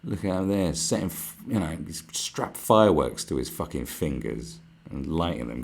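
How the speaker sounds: ordinary talk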